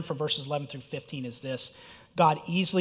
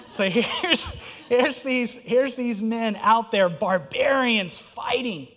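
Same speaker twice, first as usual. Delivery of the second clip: laughing; 6 semitones higher, 5 dB louder, slower than usual. Real, not acted. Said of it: "I really hope I'm not really digging a hole".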